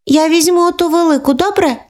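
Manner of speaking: put-on high hopeful child voice; asking little girl. Younger sister asking for the big doll, high child voice. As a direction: ask sweetly for the big doll.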